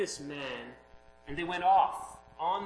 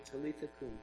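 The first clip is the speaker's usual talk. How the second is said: hushed and simple; 10 dB quieter, faster than usual. Acted. Jesus' words, said simply.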